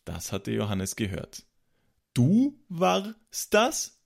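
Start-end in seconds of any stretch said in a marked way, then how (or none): 2.1-4.0 slow, high, aghast question; horrified exclamation. A narrator's reading. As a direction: draw it out high in disbelief. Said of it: "rief er entsetzt".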